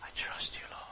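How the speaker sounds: soft breathy whisper of trust; hushed whisper before the Lord; whispered prayer. Shown as a demonstration. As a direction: whisper it to God, barely voiced. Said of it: "as you whisper before him".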